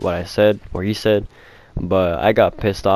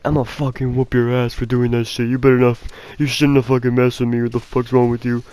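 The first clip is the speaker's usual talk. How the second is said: imitation of a threatening bully, pitched up; mock angry threat; 3 semitones higher than usual. Bully confronting the kid who threw the eraser, threatening. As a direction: put on a bully's higher, indignant voice.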